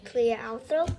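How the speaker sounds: ordinary delivery, nothing marked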